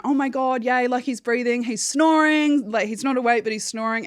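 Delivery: high, bright burst of retold relief; joyful relief. Telling the phone operator he's snoring, excited and relieved. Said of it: "So my happiness".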